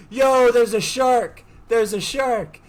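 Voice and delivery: high, loud shark alert; retold warning call. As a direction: call it out across the water. Swimmers calling out shark warning.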